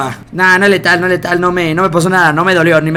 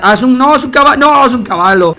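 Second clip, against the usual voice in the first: surprised, higher with each restart; rising surprise; 7 semitones higher, 5 dB louder than usual. Real, not acted. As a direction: pitch climbs high in confusion. Confused at a shape in the dark.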